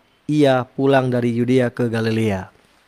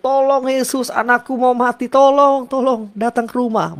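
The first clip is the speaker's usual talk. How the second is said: high pleading cry for help; desperate father; 10 semitones higher than usual. Retold or imitated. Father begs Jesus to save his dying son, high and pleading. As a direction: beg like a frantic father whose child is dying. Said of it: "seorang bapak ini bilang".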